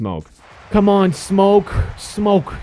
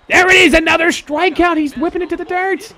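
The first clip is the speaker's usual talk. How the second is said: much higher, thrilled call; excited play call after a strikeout; excited cheer; 13 semitones higher than usual. Real, not acted. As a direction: celebrate the strikeout, pitch jumping up. Celebrating a strikeout.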